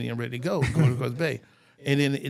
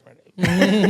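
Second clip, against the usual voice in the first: laughing; 7 semitones higher, 11 dB louder than usual. Retold or imitated. Refusing more bourbon, laughing.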